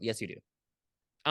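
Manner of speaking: ordinary delivery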